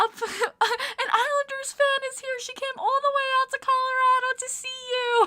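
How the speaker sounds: very high, loud imitated holler; Taylor yelling. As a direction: screech the news across a car park. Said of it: "my friend Taylor goes".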